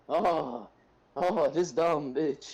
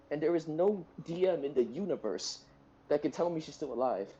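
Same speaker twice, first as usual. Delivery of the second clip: usual speaking voice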